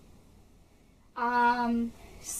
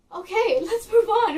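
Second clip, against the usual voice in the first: much higher and louder, shaking off the tears; bright reset; 10 semitones higher, 9 dB louder than usual. Real, not acted. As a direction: burst back to cheerful energy. Snaps out of emotional moment.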